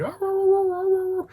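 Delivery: very high yipping coyote calls; coyote yips. Retold or imitated. Storyteller imitating the coyotes circling camp, high yips. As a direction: yip like a pack of coyotes. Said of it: "all these coyotes are sounding".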